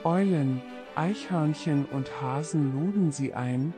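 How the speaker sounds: ordinary delivery, nothing marked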